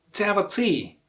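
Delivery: DEVOTEE with a long, strong TEE at the end; devo-TEE, lean on the last syllable of devotee; last-syllable stress. Shown as a demonstration. Teacher quizzing learners, stressed on TEE. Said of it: "Of course, the last one".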